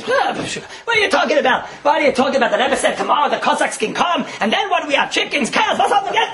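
high, hammed-up scolding wife; mock exasperated wife. Wife scolding husband over leaving everything, high and exasperated.

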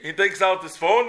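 ordinary talk

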